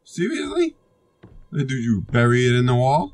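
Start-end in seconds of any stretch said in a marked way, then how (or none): 0.0-0.8 high disbelief. Real, not acted. Game takes his item.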